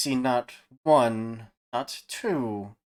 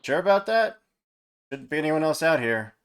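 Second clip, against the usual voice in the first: first mate voice; 6 semitones higher, 6 dB louder than usual. Acted.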